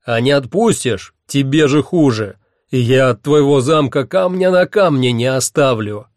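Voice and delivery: loud, forceful threat; booming menace; yelling. A narrator's reading. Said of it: "как мне удавалось так орать".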